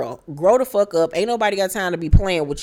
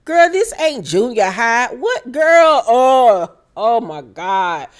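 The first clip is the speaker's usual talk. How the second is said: disbelief; 4 semitones higher, 4 dB louder, slower than usual. Real, not acted. Reacting to clique talk.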